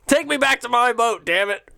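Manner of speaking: high-pitched, hammed-up indignant demand; mocking captain's demand. Captain regretting boarding the boat, mocking demand. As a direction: mimic a stranded captain's helpless bluster. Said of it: "why the fuck am I on this boat".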